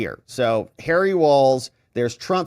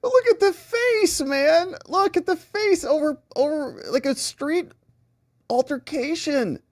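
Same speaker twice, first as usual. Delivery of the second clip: high-pitched, tickled amazement; amused exclaiming; 11 semitones higher than usual. Real, not acted. Host pointing out the face in the clip, highly amused. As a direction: voice pitched way up, just after laughing.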